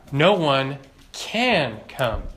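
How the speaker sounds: "can" drawn out slowly for weight; slow right down to lean on "can"; stress on CAN. Real, not acted. Preacher showing a limit on man's ability, stressing "can".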